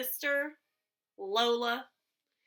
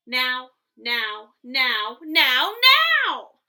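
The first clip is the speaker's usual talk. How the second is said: each now louder than the last, building to a shout; little sister insisting, from firm to a loud shout; insistent demand; 10 dB louder, much slower than usual. Acted. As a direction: demand it like a kid who can't wait. Lola demanding the book now.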